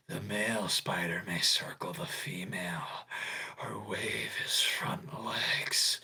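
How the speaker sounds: soft, whispery put-on creep; Discord mod voice. Host reading Peter's spider courtship lines, whispered Discord mod voice. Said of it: "I'm gonna do this in my Discord mod voice".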